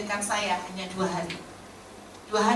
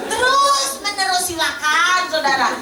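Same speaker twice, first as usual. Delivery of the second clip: emphatic preaching; 7 semitones higher, 6 dB louder, slower than usual. Real, not acted. Preacher stresses the refrain.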